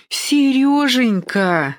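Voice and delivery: high, drawn-out delighted exclamation; perking up. A narrator's reading. Aunt recognising her nephew Серёжа, perking up. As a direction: draw the name out high, suddenly livened up. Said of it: "оживилась она".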